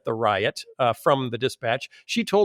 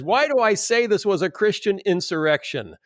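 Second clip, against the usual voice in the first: lightly raised reading voice; quoted columnist, read a touch higher; reading the author aloud; 6 semitones higher than usual. Retold or imitated. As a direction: read the quote with a bit of heat. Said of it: "He says".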